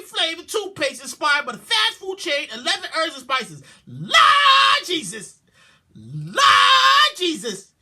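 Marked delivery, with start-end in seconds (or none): 3.9-7.7 slow anguished shout; horrified cry. Real, not acted. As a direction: cry out to the Lord in dismay. Horrified exclamation.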